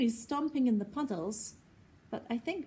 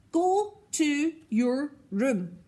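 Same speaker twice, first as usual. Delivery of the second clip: stern order; 6 semitones higher, 7 dB louder, slower than usual. A narrator's reading. Mum sends Henry away.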